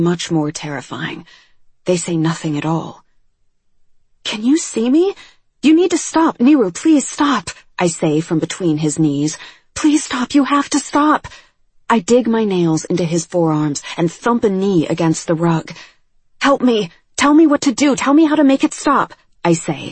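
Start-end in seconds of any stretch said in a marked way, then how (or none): 4.2-7.5 high, loud desperate begging; frantic pleading. A narrator's reading. Kneeling girl begging her panicking friend to stop, frantic. 9.8-11.2 high, loud desperate begging; frantic pleading. A narrator's reading. Kneeling girl begging her panicking friend to stop, frantic. 16.5-19.1 high, loud, rushing plea; desperate begging. A narrator's reading. Frightened girl begging her friend what to do, desperate.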